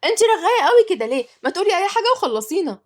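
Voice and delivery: put-on high, loud children's shout; shrieking. A narrator's reading. Twins cutting off the chatty nanny, shrieking high and loud. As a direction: yell at her, fed up. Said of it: "قطعت التوأم بصريخ".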